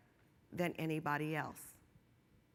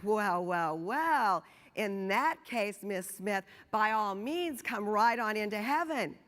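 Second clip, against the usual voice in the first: smiling Saint Peter; 3 semitones higher, 6 dB louder than usual. Acted. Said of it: "got a big smile on his face".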